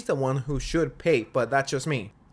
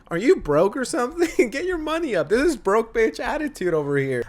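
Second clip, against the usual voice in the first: mock taunting; 7 semitones higher than usual. Mock taunting the complaining date.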